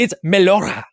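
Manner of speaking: Melora in a hammy fake Greek accent; mock Greek accent, hammed up. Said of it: "pronounce everything with a fake Greek accent".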